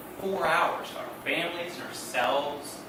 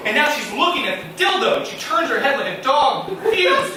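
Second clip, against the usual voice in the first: ordinary talk, nothing marked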